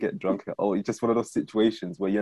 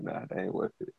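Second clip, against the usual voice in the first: much softer, half-whispered dismissal; vowing off drink, softly muttered; muttered aside; 9 dB quieter than usual. Retold or imitated. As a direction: toss it off under your breath. Muttered inner thought.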